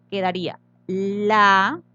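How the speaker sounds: LAPICERO sílaba por sílaba, con pausas largas; separado en sílabas. Shown as a demonstration. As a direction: decir lapicero muy lento, cortado en la-pi-ce-ro. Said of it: "Vamos a separar en sílaba esta palabra". (lapicero dividido en sílabas, cada una alargada)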